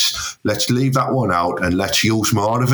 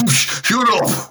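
laughing; 8 semitones higher than usual. Real, not acted. Laughing reply.

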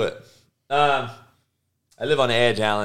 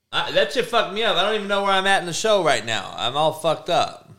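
ordinary talk, nothing marked

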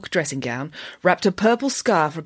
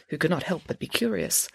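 ordinary delivery

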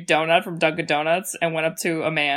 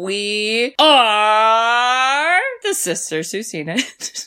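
slow announcer read of the show name; drawn-out announcement; 3 semitones higher, much slower than usual. Acted. Sister announcing the podcast name, slow and grand. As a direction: stretch the name out like a host. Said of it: "podcast show name announced".

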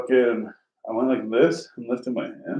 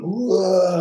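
imitated yell, high and loud; waking yell; 8 semitones higher, 5 dB louder than usual. Man waking while reaching for the door, imitated yell. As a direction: burst out in a higher, louder wordless yell. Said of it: "yelling. So I wake up going".

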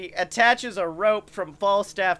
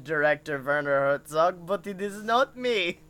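put-on lightly done German Herzog accent; understated Herzog German accent; Werner Herzog impression. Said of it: "He talks a lot like him".

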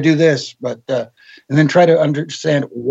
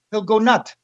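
higher French Canadian imitation, laughing through it; Pat's French Canadian accent; 7 semitones higher than usual. Joking as Pat to Jack, mock French Canadian accent. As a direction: drop the end of "nut", French Canadian style. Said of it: "you must know the French Canadian".

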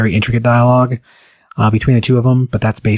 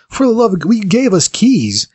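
pitched way up, slow, exasperated protest; 10 semitones higher than usual. Retold or imitated. Guest protesting to the hotel clerk, high mock exasperation. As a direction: throw up your hands at the clerk, mock outrage.

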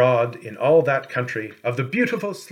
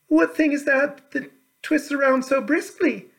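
a bright high princess calling a greeting; 14 semitones higher than usual. A narrator's reading. Curious princess asks.

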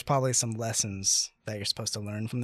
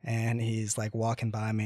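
ordinary delivery

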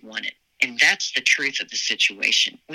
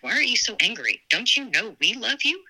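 higher-pitched imitation of a mother; pleading mother; 7 semitones higher than usual. Mother asking her angry child why, retold pleading. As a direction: lightly voice a mother's puzzled reproach.

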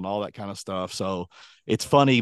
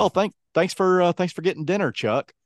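Larry David; 8 semitones higher than usual. Retold or imitated. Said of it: "he's like".

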